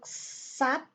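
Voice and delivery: sap with strong SA and nearly silent final vowel; stress on SA. Shown as a demonstration. Teacher models sapo, stress on first syllable. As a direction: say sap as SA-p, weak tail. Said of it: "on donne l'accent tonique".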